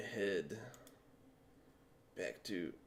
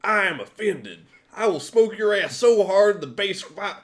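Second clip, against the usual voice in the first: drill sergeant; 10 semitones higher, 15 dB louder than usual. Acted. Drill sergeant yells at recruit.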